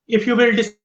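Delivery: usual speaking voice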